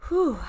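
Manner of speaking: long audible whew of a breath out; slow release breath. Shown as a demonstration. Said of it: "So let's breathe".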